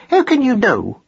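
high, challenging retort; sharp, disbelieving mouse mother; snappy. A narrator's reading. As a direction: snap the question, pitched right up. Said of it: "something like her usual snappiness".

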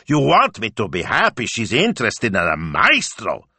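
loud, high sarcastic rant; mounting indignation, rising high; indignant outburst. A narrator's reading. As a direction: burst out incredulous and outraged. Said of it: "Signor Speranza burst out".

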